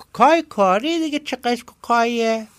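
high, slow comic henchman; a squeaky sidekick straining to see; high nasal lackey voice asking; Ayyub's high voice. Acted. Ayyub puzzles aloud.

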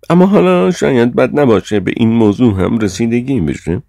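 high, loud, formal rebuke; Sir John, reproving chairman. A narrator's reading.

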